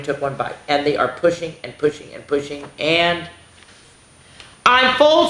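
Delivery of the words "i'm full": put-on high, loud old lady. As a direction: squeak it out as the stuffed lady. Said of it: "she said".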